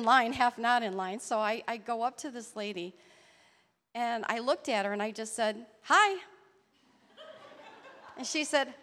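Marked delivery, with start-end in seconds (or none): 5.8-6.2 high, loud greeting; bright hi. Retold or imitated. Woman greeting a stranger in a store aisle, high and loud.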